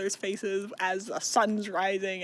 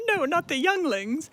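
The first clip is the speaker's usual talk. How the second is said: high, loud mock horror; mock dismay; 8 semitones higher, 5 dB louder than usual. Retelling Tamlin hearing of taken younglings, mock dismay. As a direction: cry out in hammed-up alarm.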